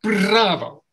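loud cheering Bravo; proud bravo. Shown as a demonstration. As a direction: call it out to the room. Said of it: "we're just going to say".

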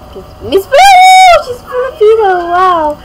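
high, loud excited cry; thrilled cry. Real, not acted. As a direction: squeal it out with delight. Thrilled at pageant contestant.

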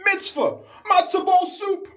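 hammed-up character barking out food words; mock character voice. Skit character tossing out words.

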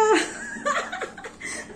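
high, breathy burst of laughter; amused high laugh. Real, not acted. Mother laughing at son's remark.

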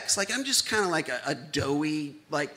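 ordinary talk, nothing marked

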